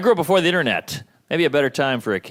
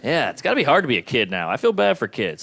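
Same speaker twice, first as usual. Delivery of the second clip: ordinary talk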